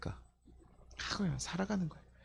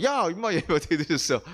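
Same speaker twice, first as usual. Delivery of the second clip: mock scolding; 3 semitones higher, 5 dB louder than usual. Pastor playing a believer scolding someone late for worship, mock angry. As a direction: bark at a latecomer, grinning through it.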